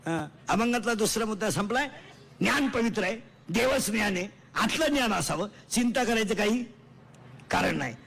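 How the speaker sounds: loud, forceful sermon points; emphatic preaching. Acted. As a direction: drive each point home loudly.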